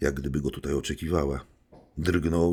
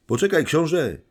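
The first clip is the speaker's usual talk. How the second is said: loud, much higher call after him; shout; 12 semitones higher, 7 dB louder than usual. A narrator's reading. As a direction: call out across the street. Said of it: "krzyknął Rogorzyn". (głośny, wysoki okrzyk)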